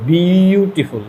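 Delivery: "বিউটিফুল" very slow, with a long-held opening "bee"; stretched syllable demonstration. Shown as a demonstration. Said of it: "এটা কিন্তু এক syllable নয়".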